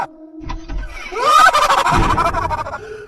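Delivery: loud, drawn-out evil cackle; mother fakes a witch cackle to scare thieves. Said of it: "अब acting मत कर".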